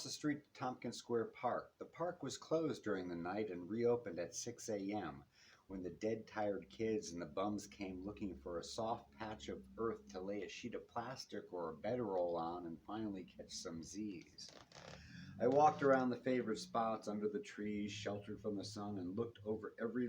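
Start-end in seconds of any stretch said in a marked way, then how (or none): none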